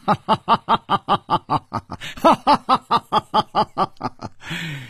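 long, high, staccato belly laugh; big laugh. A narrator's reading. Chu Liuxiang bursts into a great laugh before his riddle. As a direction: roar with theatrical laughter in rapid ha-ha bursts. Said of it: "楚留香大笑".

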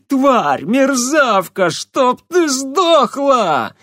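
high, loud, furious shrieking; rage-filled witch screaming, building; hysterical screaming. Acted. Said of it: "истерично кричала она". (истерично кричит)

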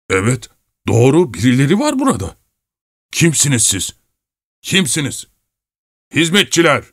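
high, startled demands; alarmed challenge. A narrator's reading. Alarmed husband to whoever is under the bed, high voice.

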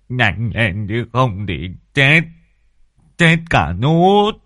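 slow, drawn-out quavering warning; frightened old man crying doom; panicked wail. Acted. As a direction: stretch each word, climbing into a loud wail. Frightened husband urges help.

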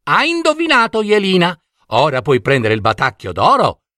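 high, fast fox praise; fox doorkeeper voice. A narrator's reading. Tatanaia praising Jelina's answer, high and quick. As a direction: congratulate her quickly like a fox. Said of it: "disse la volpe Tatanaia".

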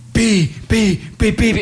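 high pi pi sounds; cooing at a baby. Acted. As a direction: try to coo at the baby up high. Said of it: "Ci provo io, ci provo". (versetti acuti per la neonata)

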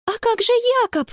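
high, quick worried question; alarmed. A narrator's reading. Sister told to turn back without her brother, alarmed. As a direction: protest in a high, hurried voice. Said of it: "К глазам снова подступили слёзы".

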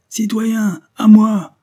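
high, loud rallying shout; crying out. A narrator's reading. Stranger hails the soldiers from behind the hedge, shouting out. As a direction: shout for your men to come. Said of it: "s'écria l'étranger".

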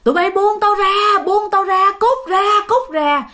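high, strained mock shouting to be let go; retold cursing yells. Possessed boy pinned down by villagers, cursing and yelling. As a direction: act out frantic yells while held down. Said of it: "cậu chửi quá trời luôn".

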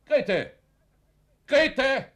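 laut nach Grete rufen, ungeduldig; brüllt durchs Haus nach Grete; lautes Rufen. Acted. (wütend laut nach Grete rufen)

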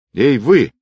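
loud, high challenge; stern call. A narrator's reading. Sergeant hailing a man in the ward, stern. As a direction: call out sternly to stop someone. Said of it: "строго окликнул его сержант".

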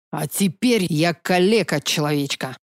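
bitter hissing dragon; furious hiss. Acted. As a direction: spit the words out in fury. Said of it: "яростно прошипел ящер".

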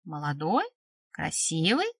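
soft, exasperated hinting; prompting with rolled eyes. A narrator's reading. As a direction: prompt them patiently, word by word. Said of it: "закатив глаза, подкинула она".

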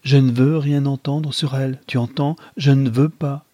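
firm and emphatic. Acted. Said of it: "dit-il avec fermeté".

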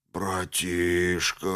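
drawn-out, low, pitiful whine; whining. Acted. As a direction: beg like a sick drunk. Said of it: "заныл деструктор".

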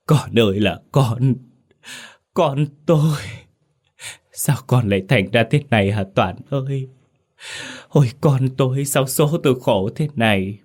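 high, wailing sobs calling her dead son; crying out for her son. Acted. Grieving mother wailing for her dead son, high and tearful. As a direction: wail it out, voice breaking with grief. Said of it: "miệng khóc gọi tên con".

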